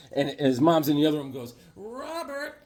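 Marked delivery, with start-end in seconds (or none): 1.8-2.6 high soft far-off mother call; high mother's call; mom calling. Retold or imitated. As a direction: call from another room like his mom. Mom calls from other room.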